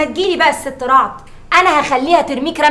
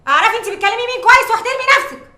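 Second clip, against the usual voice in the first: higher stern rebuke; haughty scolding; 8 semitones higher than usual. Acted. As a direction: demand respect from the maid. Madam scolding maid. (توبيخ متعالي)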